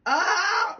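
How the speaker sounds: high, loud empty-lung scream; breathless scream. Shown as a demonstration. Vlogger trying the no-air scream trend, loud high scream. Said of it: "here goes nothing".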